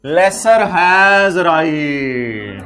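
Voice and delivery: very slow, stretched-out key phrase; drawn-out emphasis. Shown as a demonstration. Teacher stressing the key lease condition to students, drawn-out emphasis. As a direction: stretch "Lesser has right" out word by word.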